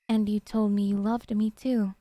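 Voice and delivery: normal talking voice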